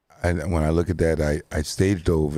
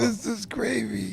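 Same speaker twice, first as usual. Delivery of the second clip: high, slow mocking echo of the question; mock-quoting a friend, high and drawn out; mock repeat; 14 semitones higher, 5 dB quieter, slower than usual. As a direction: parrot his line back in a silly high voice. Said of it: "He said".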